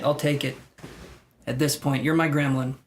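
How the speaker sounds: ordinary talk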